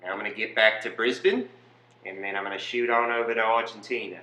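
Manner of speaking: matter-of-fact mock Australian accent; Australian Nazi. Nazi excusing himself as following orders, mock Australian accent. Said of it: "You're an Australian Nazi".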